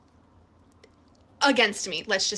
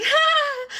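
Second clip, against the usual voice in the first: high, loud shocked ah; shocked gasp; 7 dB louder than usual. Retold or imitated. Reacting to boyfriend's independent vote, high shocked gasp. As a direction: gasp in disbelief. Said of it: "I was like".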